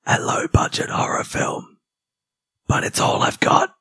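hoarse laryngitis whisper, voiceless and strained; breathless whisper of a man who lost his voice. Retold or imitated. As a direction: rasp it out with no voice left. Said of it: "my opening sentence was".

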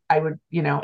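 ordinary talk

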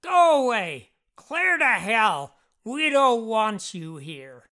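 loud, slow, drawn-out yell; screaming. A narrator's reading. Jeff screams at unwelcome visitors, loud and drawn-out. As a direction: scream to drive them out. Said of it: "Jeff would scream".

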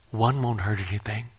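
soft, sly whisper of temptation; a quiet voice luring him in; tempting inner voice. Retold or imitated. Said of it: "and I heard a voice".

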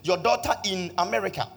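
loud, high preaching that climbs toward the miracle; full-force preaching. Acted. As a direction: preach it out to the congregation, building with every line.

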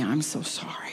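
hushed, broken whisper of apology; whispered repentance. Retold or imitated. Janice whispering her apology to Jesus, hushed and contrite. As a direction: breathe the apology out with no voice. Retold prayer of repentance on floor.

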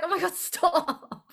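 slow, laughing "stop"; laughing plea. Retold or imitated. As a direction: beg through the laughter. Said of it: "And I was like".

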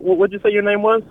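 ordinary delivery

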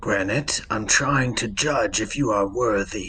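measured, formal and composed; stately, even-voiced elder cleric; solemn temple figure. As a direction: judge them calmly with grave authority. Game master voices solemn figure.

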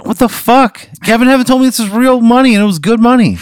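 high-pitched, incredulous complaint; mock outrage, voice shooting up; indignant protest. Player protests being sent to jail.

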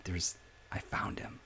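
slow, breathy whisper of discovery; hushed discovery. Acted. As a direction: whisper it like a secret found. Climax of the bed image.

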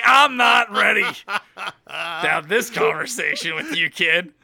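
loud, high start, drawn out and settling; mock panic. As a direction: exaggerate a flustered dad's alarm, half laughing. Dad's panicked reply to daughter, retold.

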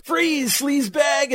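high, slow hammed-up shout of the cop's line; mock cop shout. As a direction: bark it out like a cartoon cop yelling. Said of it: "and he goes".

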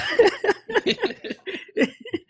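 real high-pitched laughter; high giggling laugh. Real, not acted. Host cracking up after apologising to the UK, high laugh.

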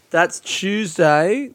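"Tuesday" drawn out slowly with a rising tune; slow emphasis. Real, not acted. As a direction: stretch "Tuesday" so it sticks. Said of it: "The first show of the run is Tuesday".

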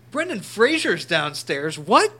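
slow, then a loud high burst of surprise on what; shocked disbelief. Retold or imitated. Retelling to friends hearing a star is downstairs, loud mock shock. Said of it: "And Andy and I are like".

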